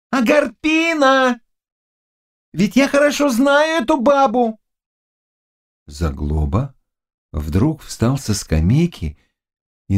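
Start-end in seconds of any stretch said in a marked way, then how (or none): 0.1-4.6 high-pitched, agitated servant's cry; excited exclamation. Acted. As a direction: draw out the name, then exclaim high. Servant reacts to the witch's name. (взволнованный возглас высоким голосом)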